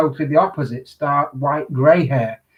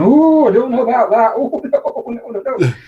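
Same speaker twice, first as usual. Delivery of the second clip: mock squeamish refusal; 7 semitones higher, 5 dB louder than usual. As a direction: imitate someone backing away from a request. Quoting stylists balking at the request.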